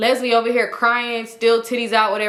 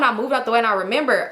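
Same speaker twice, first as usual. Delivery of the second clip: ordinary talk, nothing marked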